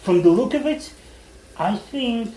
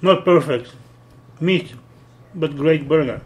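chewed words between bites; talking while chewing; 4 dB louder than usual. Real, not acted. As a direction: mouth partly full of food. Seen 2: Bites burger at -2, lips pursed, visibly chewing while talking.

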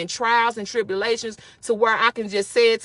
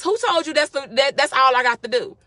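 normal talking voice

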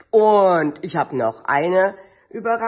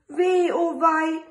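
a touch of mock sorrow, pitched up; lightly voiced sad mother hen sighing; 10 semitones higher, slower than usual. Mother hen laments her lost egg, mildly sad and higher. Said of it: "Frau Huhn sagt traurig".

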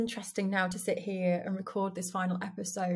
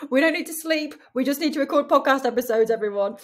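higher, louder and quick, mock-fervent; playful joke; 5 semitones higher, 8 dB louder, faster than usual. Real, not acted. Telling listeners she'll record, not sleep, playfully loud. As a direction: announce it like a cheeky rallying cry. Joking to listeners about priorities.

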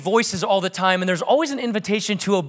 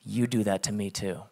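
much lower, softer still voice; Holy Spirit voice; 10 semitones lower, 8 dB quieter than usual. Retold or imitated. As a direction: say it quietly like a gentle inner voice. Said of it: "I heard the Holy Spirit say".

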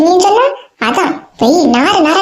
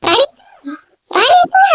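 high, loud call across the house; 6 semitones higher than usual. Acted. Woman excitedly calling out to her friend Rani. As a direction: shout her friend's name to get her attention. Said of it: "அட கத்தாம வாங்கக்கா".

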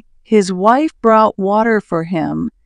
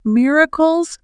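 a touch of surprise, pitched up; mildly surprised; 7 semitones higher than usual. A narrator's reading. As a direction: echo it as a high, puzzled question. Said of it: "Her poor husband was very surprised".

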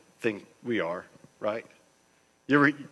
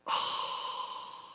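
breathy relief sigh; 6 dB quieter than usual. Retold or imitated. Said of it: "you're like".

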